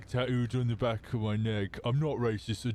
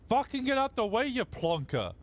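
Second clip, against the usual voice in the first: mock yell; 11 semitones higher than usual. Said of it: "I was like".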